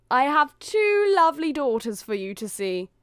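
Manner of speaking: high, loud show-off pitch; stepmother. A narrator's reading. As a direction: present the daughters eagerly to the prince. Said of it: "The stepmother flew open the door".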